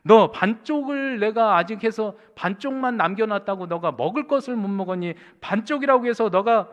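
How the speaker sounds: loud, high, impassioned pleading rebuke; God's reproving voice. Retold or imitated. God to the despairing pastor, loud impassioned reproof. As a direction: cry out as God, reproaching a beloved servant. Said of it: "갑자기 하나님이 음성같이".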